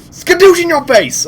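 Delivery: high, loud mock battle cry; high-pitched burst of gloating; playful exclamation. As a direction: blurt it high like a cartoon attack. Mimics a sudden kill in game.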